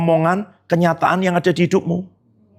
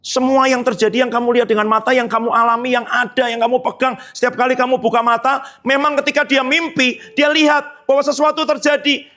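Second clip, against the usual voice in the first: high, driving preacher's voice; rising preaching; 9 semitones higher than usual. Acted. Preacher rallying the children about Joseph's dream, fervent. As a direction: preach it up to the congregation.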